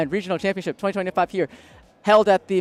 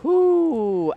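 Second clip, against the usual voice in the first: excited whoo; 11 semitones higher, 5 dB louder than usual. Real, not acted. Cheering a wild game finish.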